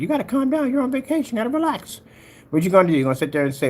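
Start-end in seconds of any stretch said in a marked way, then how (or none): 0.0-2.0 high, sing-song mock soothing. Host imitating people telling an angry viewer to relax, high and mocking. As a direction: imitate someone fussily calming you down. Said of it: "They're like".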